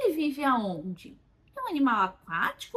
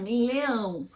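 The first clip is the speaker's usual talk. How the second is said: slow drawn-out leão. Shown as a demonstration. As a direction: say leão slowly, drawing it out. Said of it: "Ok, um leão". (leão dito devagar, arrastado)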